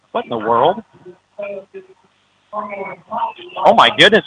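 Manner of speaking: stunned, slow oh my goodness; baffled, drawn out disbelief; astonished. Real, not acted. Confusion at fumble in fog.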